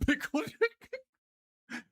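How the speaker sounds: slow, very high laughing pickle liquor; laughing disbelief. Real, not acted. Teller laughing at his own line. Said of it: "laughs, pickle liquor".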